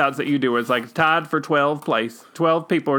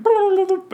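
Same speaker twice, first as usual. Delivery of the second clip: much higher, louder "bloop" noise; silly alien bloop; 3 dB louder than usual. Retold or imitated. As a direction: pop out a cartoon alien bloop. Imitating aliens descending on ride.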